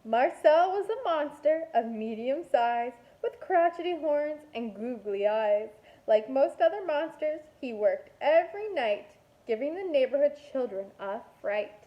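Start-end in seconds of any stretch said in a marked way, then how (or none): none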